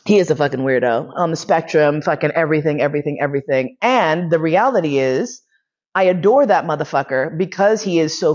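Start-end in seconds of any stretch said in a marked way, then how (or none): none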